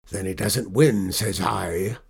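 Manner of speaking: loud pirate verdict, slowing at the end; pirate captain. A narrator's reading. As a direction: lay down the law as a pirate. Pirate argues over surrender.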